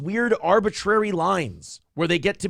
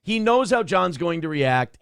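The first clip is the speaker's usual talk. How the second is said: usual speaking voice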